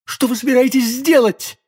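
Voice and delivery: high-pitched panicked question; alarmed cry. A narrator's reading. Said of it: "вскричала госпожа Бонасье". (испуганный возглас высоким голосом)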